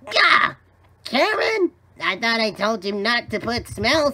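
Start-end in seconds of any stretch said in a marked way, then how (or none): 0.0-1.7 high-pitched cry of disgust; exasperated outburst. Acted. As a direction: cry out high, appalled and annoyed. Disgusted villain yells at wife.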